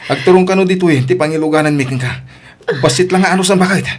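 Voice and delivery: flustered alarm. Acted. Husband hearing his wife is in labour, flustered and loud. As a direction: scramble in a panic, half laughing.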